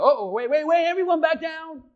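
mock-panicked call, climbing high and loud; comic alarm. Preacher as Jesus after everyone rises by accident, comic panic. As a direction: hastily call off a mistake. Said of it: "he's like".

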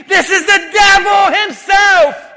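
high, loud, slow accusation; accusing shout. Acted. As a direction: denounce him loudly, drawing the words out. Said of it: "Some people went as far as saying".

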